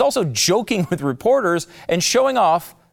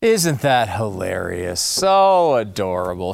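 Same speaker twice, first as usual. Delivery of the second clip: slow, lower, dripping with mock delight; sarcastic; 6 semitones lower, slower than usual. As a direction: pretend to be charmed while sneering. Host mocks the brothers' TV moment.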